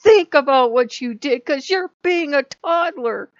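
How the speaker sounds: put-on a mother scolding a toddler god, loud. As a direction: lay into them like a naughty toddler. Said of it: "I yelled at them like they were Loki".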